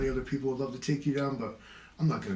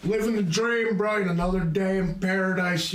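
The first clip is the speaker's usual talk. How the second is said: higher, louder fake cheer; mock sarcastic cheer; 7 semitones higher, 5 dB louder than usual. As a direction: sell a lie with forced cheer. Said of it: "Or you get the".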